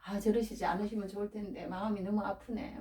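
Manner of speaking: soft, mildly pained lament; acted-out quiet griping; voiced hurt complaint. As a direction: complain gently, as if wounded.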